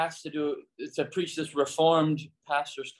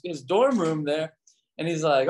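ordinary delivery

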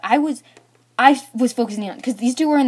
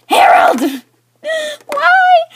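loud, high dramatic wail; anguished scream; 11 dB louder, much slower than usual. Retold or imitated. Girl crying out at the boy who tripped her, anguished scream. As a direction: scream at him, pitch soaring on the last word.

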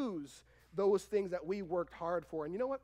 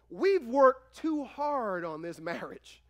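loud, slow, insistent wife; hammed-up stern wife; mock wife's protest; 5 semitones higher, 10 dB louder, much slower than usual. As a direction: lay down the law like a fed-up wife. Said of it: "Your wife is gonna go".

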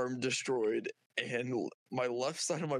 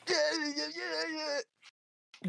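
whiny resignation; 13 semitones higher, much slower than usual. Acted. Resigned after being fed horse.

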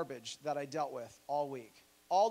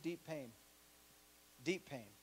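quiet emphasis; 4 semitones lower, 11 dB quieter than usual. Real, not acted. Repeated for weight, dropping off.

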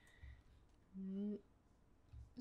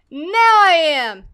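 loud, drawn-out declaration; emphatic outburst; loud exclamation; 2 semitones higher, 12 dB louder, slower than usual. Real, not acted. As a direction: announce it forcefully, stretching it out. Finally through the door, exclaims.

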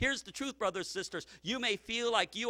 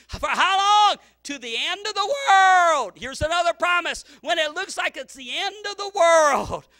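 rising, louder revival preaching; a pastor warming up the church; full force preaching; 6 semitones higher, 10 dB louder than usual. Acted. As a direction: preach it with growing fire.

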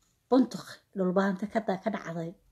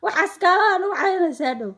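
higher, louder imitation of an agitated daughter; pleading daughter quote; 9 semitones higher, 5 dB louder, faster than usual. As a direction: act out her loud protest to mother. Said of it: "Waxay leedahay".

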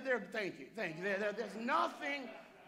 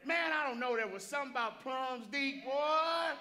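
southern voice; 5 semitones higher, 3 dB louder than usual. Shown as a demonstration. Preacher telling the congregation about plums, exuberant Southern voice. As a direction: lay on an excited down-home Southern voice. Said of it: "That's, that's my southern voice".

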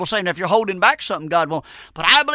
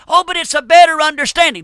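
high, loud sneering parody of an excuse; mocking whine; 7 semitones higher, 6 dB louder than usual. Preacher parodies a modern-Bible reader's excuse, high and loud in mockery. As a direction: mimic the opponent's excuse, high and loud in scorn.

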